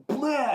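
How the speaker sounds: high, loud splatting noise; one exaggerated whack sound effect; imitated rake blow. Andrea's last rake swing, loud imitated blow. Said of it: "And one more time".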